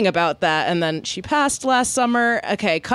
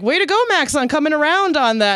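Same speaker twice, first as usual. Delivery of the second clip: mock cheering; 8 semitones higher, 4 dB louder, faster than usual. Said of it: "they're like".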